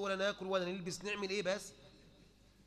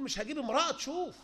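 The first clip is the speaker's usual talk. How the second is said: mocking the sheikh's ruling, pitched up; mocking sheikh, higher; 6 semitones higher, 4 dB louder than usual. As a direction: quote him high and a bit louder. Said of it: "فضيلة الشيخ تقول".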